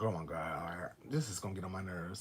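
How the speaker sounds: low, hushed mutter to herself; annoyed aside. Retold or imitated. Woman thinking to herself in the car with her drunk ex, annoyed aside. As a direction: grumble it under the breath, low and soft. Inner annoyed reaction, retold.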